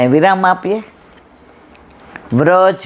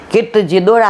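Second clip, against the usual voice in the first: normal talking voice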